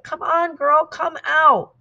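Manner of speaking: high, loud, drawn-out holler; shouted call. A narrator's reading. Someone aboard the Alsace calling to the swimmer, shouted. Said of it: "Finally, someone shouted".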